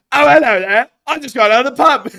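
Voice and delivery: put-on very high, loud drunk Cockney caricature; hammed-up Cockney; Cockney accent. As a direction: announce it like a pub drunk. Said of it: "our Cockney accents".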